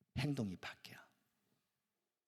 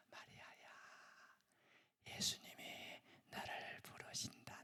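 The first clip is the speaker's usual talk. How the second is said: changed Martha's whisper; 16 dB quieter than usual. Retold or imitated. The changed Martha quietly whispers to Mary in her ear. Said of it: "조용히 문을 열고 조용히 동생 댁에 가서 귀에다가".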